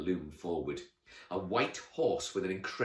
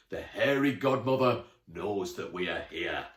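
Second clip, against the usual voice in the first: ominous knight voice; 5 dB louder than usual. A narrator's reading.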